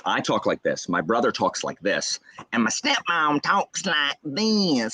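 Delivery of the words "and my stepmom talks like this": slow, high Southern twang; stepmom's Southern accent. Shown as a demonstration. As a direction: stretch every vowel in an exaggerated Southern accent. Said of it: "they have strong Southern accents".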